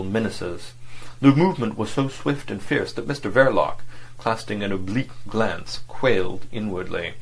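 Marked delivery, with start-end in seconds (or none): none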